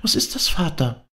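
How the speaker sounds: put-on high, anxious voice of a small child; fearful child's whisper. A narrator's reading. Child fearfully asks her father at the deathbed, anxious whisper. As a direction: ask it small and scared, pitched high. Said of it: "flüsterte sie angstvoll".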